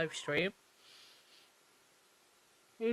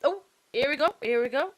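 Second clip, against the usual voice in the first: higher, louder eager announcement; sudden excitement at movement; excited; 6 semitones higher, 5 dB louder, faster than usual. Real, not acted. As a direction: alert the viewers something's happening. Judge returning to court.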